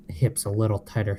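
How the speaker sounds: ordinary talk, nothing marked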